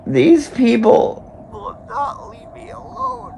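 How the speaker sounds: loud, slow, high crying complaint; slow tearful wail. Real, not acted. Drunk streamer crying to viewers, loud tearful wail. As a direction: drag it out through tears.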